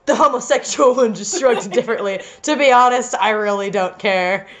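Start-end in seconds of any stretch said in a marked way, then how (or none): none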